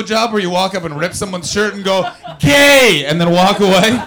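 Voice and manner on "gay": Edinburgh person mocking. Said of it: "I was doing an impression of an Edinburgh person".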